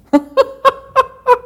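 high, loud carefree laugh; joyous laugh. Acted. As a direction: let go with delight. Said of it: "then he laughed".

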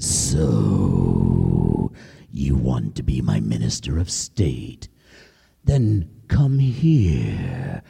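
deep, slow menacing lion voice; low, drawn-out royal lion; lion king. Acted. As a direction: growl the test like a hungry king. Lion speaks to the animals.

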